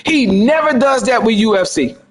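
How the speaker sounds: ordinary delivery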